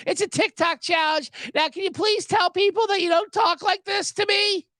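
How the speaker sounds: high-pitched imitation of a woman laughing it off; mock nervous laugher. Girlfriend explaining TikTok challenge to snapping guy, mock laughing. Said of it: "she's laughing, like".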